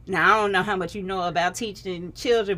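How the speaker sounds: normal talking voice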